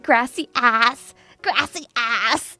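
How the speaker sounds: "Grassy ass" drawn out slowly as English words; mispronounced gracias. Retold or imitated. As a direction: stretch "grassy ass" as a childish pun on Spanish. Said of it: "I was like, like".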